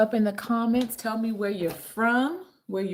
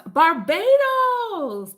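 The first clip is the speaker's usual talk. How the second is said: loud, high, long-drawn shout-out; slow, soaring excited call; excited holler; 11 semitones higher, 8 dB louder, much slower than usual. Real, not acted. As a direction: holler the island's name, stretching it out. Excited greeting to Barbados viewer.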